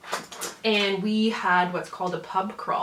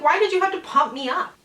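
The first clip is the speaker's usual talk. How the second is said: mock exasperation; 9 semitones higher, 4 dB louder than usual. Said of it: "I'm like".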